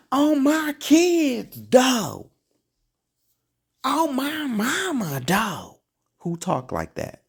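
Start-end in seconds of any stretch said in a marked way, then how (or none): 0.1-2.3 high, loud, slow mocking squeal; mock their oath in a shrill-high drawn-out voice; swearing on my kids in a sarcastic high voice; mocking exclamation. Mocking the people's curse. 3.8-5.8 high, slowed mock exclamation; mocking oath.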